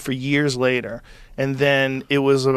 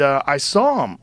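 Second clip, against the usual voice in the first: ordinary talk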